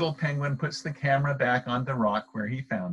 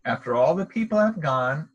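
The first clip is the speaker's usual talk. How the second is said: usual speaking voice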